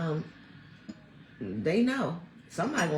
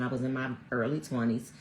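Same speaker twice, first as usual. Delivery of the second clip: normal talking voice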